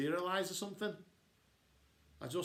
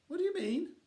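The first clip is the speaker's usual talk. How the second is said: wounded question; 3 dB louder than usual. Acted. Florida hurt, asks.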